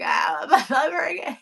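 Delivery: hammed-up crying wail breaking into laughter; mock blubbering. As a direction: imitate your own messy bawling. Mimics herself blubbering over cat.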